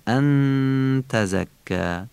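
"ان" drawn out with a held, humming n; nasal ghunna. Shown as a demonstration. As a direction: demonstrate "ان تزکا" slowly, nasalising the noon.

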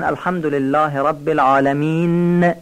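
العالمین with the last n hummed on and doubled, "alaminnn"; wrongly prolong the closing n in العالمین; overdoubled final letter. Shown as a demonstration. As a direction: lean too hard on the final n of العالمین.

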